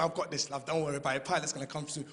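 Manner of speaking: low, soft reassuring passenger; calm the stranger, stiff upper lip; all British calm. Retold or imitated. Passenger reassuring a nervous woman, soft British calm. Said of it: "getting all British over".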